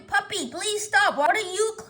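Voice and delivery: ordinary delivery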